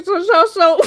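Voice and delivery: high, loud stammer through giggles; words bubbling up through real laughter; laughing. Real, not acted. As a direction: try to restart while cracking up. Laughing while resuming the story.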